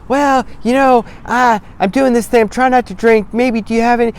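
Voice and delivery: high, hesitant mock apology; timid mumbling. Shown as a demonstration. Mocking a guest who apologizes to the host, high and timid. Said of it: "You don't need to say".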